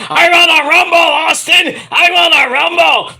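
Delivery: high, loud, raspy hype yell; yelling to Austin as Terry Funk; Terry Funk shout. Retold or imitated. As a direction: roar it like a crazed old wrestler. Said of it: "And he's like".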